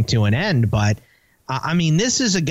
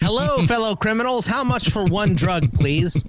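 Steve Buscemi; 7 semitones higher than usual. Retold or imitated. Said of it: "like the Steve Buscemi skateboard thing".

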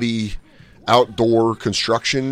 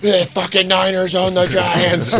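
high, slow shouted taunt; 8 semitones higher, 4 dB louder, slower than usual. Retold or imitated. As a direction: taunt him across the arena. Taunting friend across crowd.

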